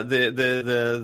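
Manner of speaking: ordinary talk, nothing marked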